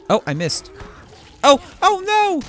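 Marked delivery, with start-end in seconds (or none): 1.4-2.4 high alarmed "oh no!"; squeaky panic. Real, not acted. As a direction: yelp in sudden alarm. Missed kick, zombie coming.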